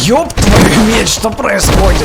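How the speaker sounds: loud, high, slow startled outburst; alarmed shout. Real, not acted. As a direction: cry out in alarm at chaos. Sudden chaos while playing.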